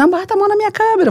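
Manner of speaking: high, rapid-fire protest; retold agitated protest. Cameraman protesting as police cover the lens, high and agitated.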